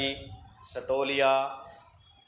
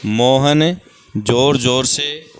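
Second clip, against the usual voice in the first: loud, drawn-out prompt of Mohan जोर जोर से; slow loud prompt; 2 semitones lower, 11 dB louder, much slower than usual. Shown as a demonstration. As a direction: stretch the words to prompt the pupil. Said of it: "teacher prompts the sentence". (ज़ोर से खींचकर बोलना)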